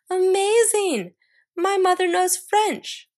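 put-on wide-eyed childish amazement, drawn out; amazed exclaim. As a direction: gush over mom as if it's magic. Nancy amazed by mom.

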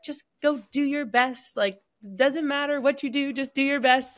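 gently imitating mom cheering her on; mom's encouragement. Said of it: "and was like".

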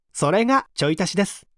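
ordinary delivery

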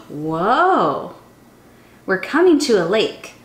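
usual speaking voice

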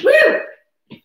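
high cheering woo; excited whoop. Real, not acted. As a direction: whoop to fire up the class. Cheering on a workout class.

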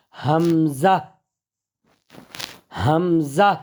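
হামজা ending in জা with air; hamza. Shown as a demonstration. Teacher repeating hamza to students. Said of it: "জা দিয়ে উচ্চারণ হবে".

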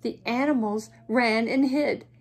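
plain reading voice

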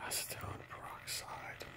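hushed secretive whisper. Real, not acted. Whispered dangerous chemical name.

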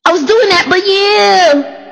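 high put-on boy's voice; Tyler. Preacher keeping up Tyler's talk, high put-on voice. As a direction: stretch it out way up high. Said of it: "that's how he talks".

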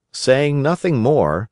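ordinary delivery

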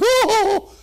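startled gasp. Retold or imitated. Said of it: "and everybody's like".

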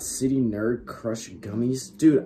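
usual speaking voice

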